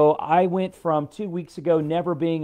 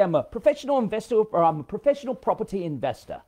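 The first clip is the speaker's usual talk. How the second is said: higher-pitched, hammed-up British accent; mock British accent; 5 semitones higher than usual. Teasing British investors to the group, mock British accent. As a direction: murder a British accent for fun. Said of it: "I'll murder the accent".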